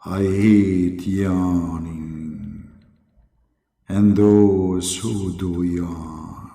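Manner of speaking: low, very slow echoing voice; deep drawn-out proclamation with echo; Allah. Acted. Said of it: "sound effect".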